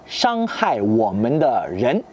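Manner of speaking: Shanghai women de ren said slowly in Mandarin with its tones; Henan dialect phrase. Shown as a demonstration. Teacher quoting Henan people's phrase to French viewers, slow Mandarin. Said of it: "tous les autres nous font du mal".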